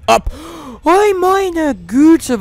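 hoch, laut und sehr gedehnt; erschrocken. Real, not acted. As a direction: zieh das Oi erschrocken lang. Schreck im Horrorspiel. (laut gedehnter Schreckensruf)

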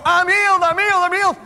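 high, loud cry of I'm healed; excited shout. Retold or imitated. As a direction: call it out full of joy. Retelling her jumping up healed.

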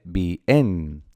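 bien drawn out as bi-en; wrong two-syllable bien. Shown as a demonstration. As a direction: split bien slowly into BI and EN. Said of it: "no se dice bien".

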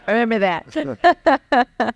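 said slowly through laughter; laughing. Real, not acted. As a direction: drag it out while still laughing. Host laughs at tape joke.

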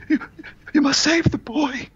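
high, strained character voice, gasping out a plea; the film doctor's high-pitched dying voice; Donald Pleasance impression. Dying man pleads to save the boy, high Donald Pleasance voice. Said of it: "sounded like Donald Pleasance".

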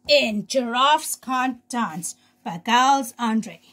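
ordinary delivery, nothing marked

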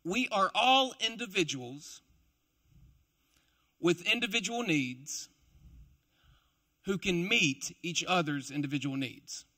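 slow, spaced-out dictation pace; slowly. Shown as a demonstration. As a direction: say it slowly so they can write it down. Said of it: "I'll try to say this slowly".

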